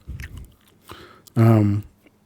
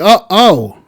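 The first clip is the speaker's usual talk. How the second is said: higher, louder surprised oh; 6 semitones higher, 5 dB louder, slower than usual. Retold or imitated. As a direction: gasp it out in surprise. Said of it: "And we're like".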